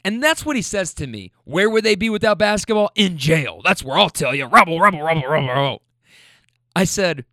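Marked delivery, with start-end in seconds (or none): 1.5-5.8 hammed-up old boomer, louder, chanting rebel; mock the grumpy old guy and his rebel chant. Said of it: "he's a boomer".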